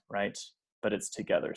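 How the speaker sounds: normal talking voice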